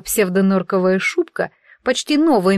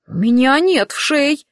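normal talking voice

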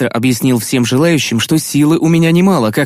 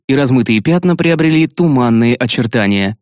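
ordinary delivery

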